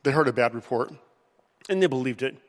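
usual speaking voice

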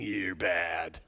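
mock the gloomy painted Jesus; somber stern Jesus. Pastor mocks a somber, judging Jesus picture, low and solemn. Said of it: "somberly looking at you and going".

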